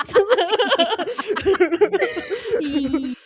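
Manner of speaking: high, wheezing burst of laughter. Real, not acted. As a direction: crack up helplessly. Laughing fit after joke.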